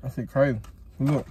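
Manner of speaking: talking with food in mouth; speaking between bites; chewing while talking. Real, not acted. Seen 1: Driver takes bites just before and holds the food box while talking.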